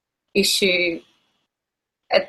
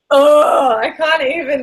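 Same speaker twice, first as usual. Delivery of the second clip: loud groan into higher-pitched annoyance; exasperated; 4 semitones higher, 5 dB louder, slower than usual. Real, not acted. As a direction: burst out in real exasperation, then ease off. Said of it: "made me so annoyed".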